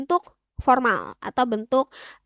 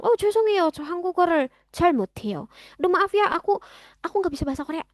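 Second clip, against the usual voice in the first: quick, higher-pitched flustered apology; acted apology; 6 semitones higher, much faster than usual. Learner placating an annoyed older Korean, acted hasty apology. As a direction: hurry out a pretend sorry, pitched up. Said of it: "Kita bisa bilang".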